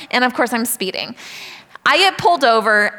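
ordinary talk, nothing marked

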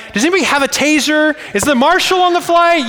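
mock rant at screaming kids on a flight; exasperated outburst. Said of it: "I'm like".

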